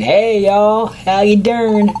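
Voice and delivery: high, drawn-out goofy greeting; some dude. Pretend. Guessing what the crystal recorded, high goofy dude. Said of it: "Could be just some dude saying".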